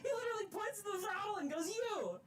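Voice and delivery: high, breathless, laughing through it; giddy excited retelling. Real, not acted. Rail fan recounting his turn at the throttle, giddy and high. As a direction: tell it bursting with joy.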